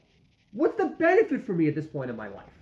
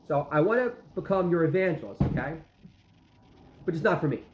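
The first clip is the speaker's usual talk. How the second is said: usual speaking voice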